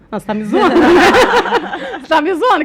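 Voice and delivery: loud, high disbelief breaking into laughter; laughing, indignant are-you-kidding outburst; incredulous protest. Retold or imitated. To the friend who advised her, laughing incredulous protest.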